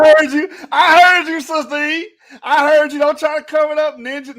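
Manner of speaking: loud, high gotcha shout; loud, teasing gotcha yell; teasing call-out. Real, not acted. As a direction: call him out loud and gleeful.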